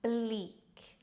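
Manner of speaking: "bleak" with a long ee and separate k; careful teaching demo of "bleak"; drawn-out pronunciation. Shown as a demonstration. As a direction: sound out "bleak" slowly. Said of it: "Pronounced as".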